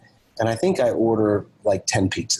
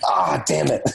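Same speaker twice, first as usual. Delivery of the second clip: higher, exasperated self-reproach; mock exasperation; 6 semitones higher than usual. Husband realises he forgot pizzas for his wife, mock exasperation. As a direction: replay a rueful "oops" moment without extra volume.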